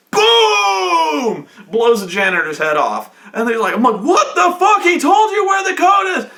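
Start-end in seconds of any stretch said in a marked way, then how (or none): 0.1-1.5 high, loud mock gunshot; a cartoon gun blast; gunshot boom. GM acting out the troll's gunshot, mock boom. 4.0-6.3 high, loud disbelieving shout; shocked yell. Retold or imitated. GM yelling at the troll player in disbelief.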